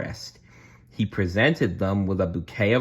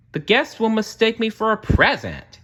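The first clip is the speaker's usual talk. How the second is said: much higher, louder dismayed cry; Cinderella crying out; 14 semitones higher, 3 dB louder than usual. A narrator's reading. Cinderella dismayed at a huge bow-covered gown, high cry. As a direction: squeal at the ridiculous dress. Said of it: "she cried".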